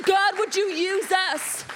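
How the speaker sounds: earnest prayer, stretched out; slow pleading prayer; slow, fervent plea. Acted. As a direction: draw out the plea slowly. Preaching climax.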